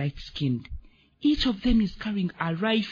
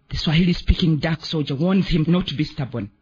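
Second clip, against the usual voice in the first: ordinary delivery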